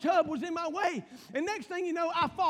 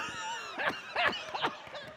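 very high shrieking cackle of a wife doubled over; imitated shrieking laugh; 5 dB quieter than usual. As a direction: mimic her helpless high-pitched laughter. Imitating Kelly dying laughing.

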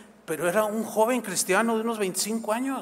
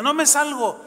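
higher and louder refusal; defiant refusal; 8 semitones higher, 5 dB louder than usual. Retold or imitated. Man refusing the policewoman's order, defiant. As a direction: dig in and refuse.

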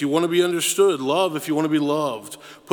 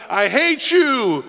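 high, loud, slow angry scream; 8 semitones higher, 4 dB louder, much slower than usual. A narrator's reading. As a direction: scream it with a raised fist. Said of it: "he screamed out".

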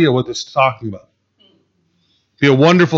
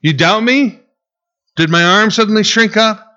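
mock offended, a bit higher; sarcastic God; 6 semitones higher than usual. Preacher voicing God's sarcastic reply to doubters, mock offended. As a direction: poke fun at their doubt. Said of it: "This is the type of sarcasm God will throw out".

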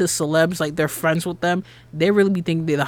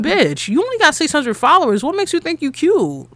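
high-pitched, scornful challenge; sassy put-down; 8 semitones higher than usual. Retold or imitated. Woman retelling a retort to a snubbing friend, sassy put-down. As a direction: take someone down a peg, pitched up.

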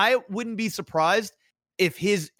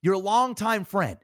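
ordinary delivery

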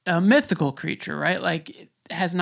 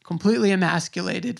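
usual speaking voice

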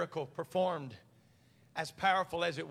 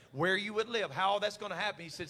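ordinary talk, nothing marked

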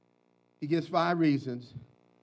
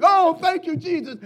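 sudden higher, louder burst of relief; relieved thanks; 10 semitones higher, 4 dB louder than usual. Retold or imitated. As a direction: gush with thanks like a caller finally hearing a person. Relieved to hear a human voice.

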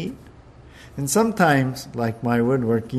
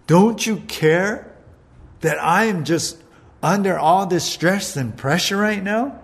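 exasperated pitched-up rant; mock frustrated rant; 5 semitones higher than usual. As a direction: play a stressed-out sister venting at Jesus. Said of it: "Martha's saying".

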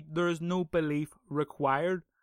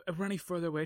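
ordinary talk

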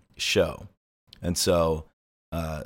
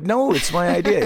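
mock childish insistence; 10 semitones higher, 4 dB louder than usual. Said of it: "I'm like".

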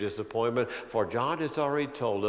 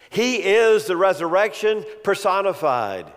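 high, loud pulpit build; preaching at full force; 6 semitones higher, 6 dB louder than usual. Acted. Preacher proclaiming Jesus as the resurrection, full preaching voice. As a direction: drive the point home to the pews.

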